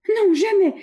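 high, loud desperate refusal; girl fighting off her captor; panicked refusal. A narrator's reading. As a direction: refuse him in shrill panic. Captive girl refusing the bandit.

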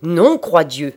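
very loud, explosive oath; thundering cry. A narrator's reading. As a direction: thunder out your objection. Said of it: "s'écria-t-il avec sa voix de tonnerre". (cri d'une voix de tonnerre)

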